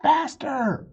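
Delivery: high urging call. A narrator's reading. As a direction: spur her on. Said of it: "called Chip".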